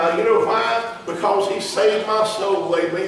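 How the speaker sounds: rising preacher's fervor; preaching. Acted. Preacher telling the congregation about his faith, preaching.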